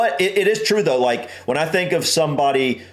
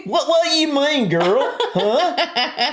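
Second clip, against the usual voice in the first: high-pitched, sassy mock Southern accent; hammed-up Southern girl talk; country Southern accent; 13 semitones higher, slower than usual. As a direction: play up a country Southern twang. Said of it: "I'm too country".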